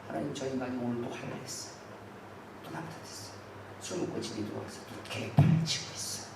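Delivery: whispered, fast tattling to God; whispered prayer. Shown as a demonstration. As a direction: hush it like a secret complaint. Secret inner prayer acted. (속삭이며 하나님께 일러바치기)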